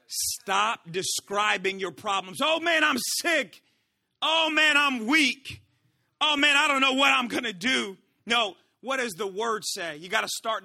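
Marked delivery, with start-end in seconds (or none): 2.4-8.0 mock complaining. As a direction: wail it like someone listing their troubles. Mimics people moaning about problems.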